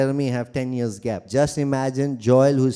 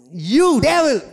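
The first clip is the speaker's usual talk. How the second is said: higher, louder mock rebuke; rebuking the devil; 10 semitones higher, 5 dB louder than usual. Shown as a demonstration. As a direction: snap it out at the devil. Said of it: "just do a little, little like this".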